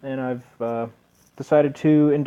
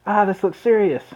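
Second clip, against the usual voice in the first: Fem Scout voice; 8 semitones higher than usual. Acted. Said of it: "So I do get to make my T F two reference".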